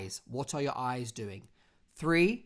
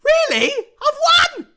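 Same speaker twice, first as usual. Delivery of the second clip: very high, shouted disbelief at winning; hammed-up ecstatic scream; over-the-top excitement; 15 dB louder, slower than usual. Shown as a demonstration. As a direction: shriek it like an overdone lottery winner. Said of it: "don't start your phone call like this".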